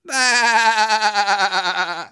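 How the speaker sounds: high, loud bleat; little tiger bleating. A narrator's reading. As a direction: bleat like a lamb. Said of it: "said the little tiger".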